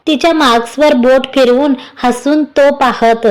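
ordinary delivery

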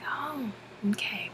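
soft slow hushed okay; slow whispered uneasy okay. Retold or imitated. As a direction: breathe it out unsettled. Startled whispered reaction.

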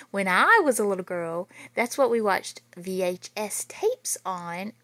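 lively read-aloud storytelling voice; story narration. A narrator's reading. Teacher reading a spooky school story to children, lively. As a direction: read it out big and lively for the kids. Reads picture book to kids.

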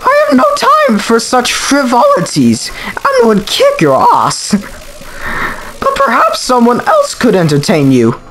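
high, haughty computer queen; disdainful villainess in a high put-on voice. Computer queen refusing to fight the children, high haughty voice. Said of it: "I'll give her a voice".

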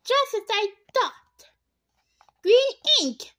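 high excited shout, pitch jumping up. A narrator's reading. Nancy shouting her discovery in triumph. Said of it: "and shouted".

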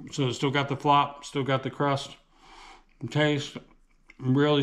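speaking between bites; talking while chewing. Real, not acted. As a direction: mouth partly full of food. Seen 0: Bites at -3; lips pursed, chewing while talking, slice in hand.

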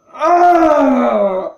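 loud, high wail of frustration; real exasperated howl; anguished groan. Real, not acted. Player berates self over mistake.

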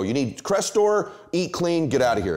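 mock the doctor who just writes a script and waves you out; dismissive doctor. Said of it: "He could still go".

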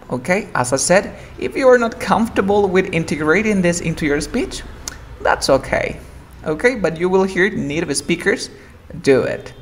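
usual speaking voice

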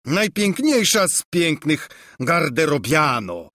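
lightly voiced amorous monarch; Valoroso. A narrator's reading. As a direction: woo the maid with pompous compliments. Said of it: "przerwał jej Valoroso".

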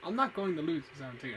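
forceful, determined outburst; loud. Real, not acted. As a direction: shout it, refusing to lose. Frustrated vow during a boss fight.

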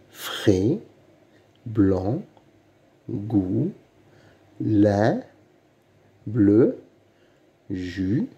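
each syllable alone, long pause after it; reading out syllables. Shown as a demonstration. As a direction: read slowly down a list of isolated syllables. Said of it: "lis les syllabes". (lecture de syllabes, une à une, bien détachées)

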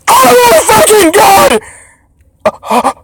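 high, drawn-out real shout; too-loud shout. Real, not acted. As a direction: blow out the volume in shock. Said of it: "my volume's way too loud".

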